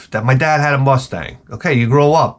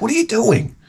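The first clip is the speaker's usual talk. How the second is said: exasperated question; 11 semitones higher than usual. Retold or imitated. Scolding the dog.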